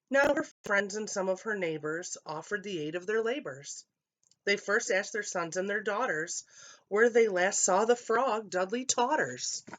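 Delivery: plain reading voice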